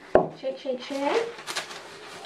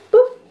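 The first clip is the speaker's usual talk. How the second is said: playful boop; 13 semitones higher, 6 dB louder than usual. Real, not acted. Playful sound while shaping dough.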